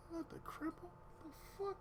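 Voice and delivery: hushed, high-pitched disbelief; small high voice of a stunned bystander; baffled onlooker. Retold or imitated.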